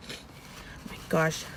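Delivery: slow, soft, teary whisper; the words barely get out through tears; overcome, whispered. Real, not acted. Moved by seeing her baby, slow teary whisper. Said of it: "I didn't know I was gonna get this emotional".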